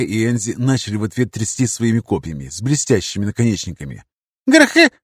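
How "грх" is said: squeaky high battle cry; a tiny girl trying to sound fierce, squeaking. Acted. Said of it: "пискнула Эсика".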